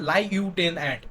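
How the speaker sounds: "lie you ten ant" broken into four plain words; spelling pronunciation. Shown as a demonstration. Teacher reminding students of the spelling, word-by-word pronunciation. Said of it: "বানানে কি focus দিছি আমরা".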